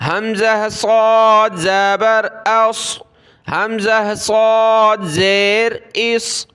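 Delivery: صاد as a full, rounded "sawd"; heavy whistled s in صاد and اس; thick whistled saad. Shown as a demonstration. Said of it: "صاد کو موٹا پڑھنا ہوتا ہے".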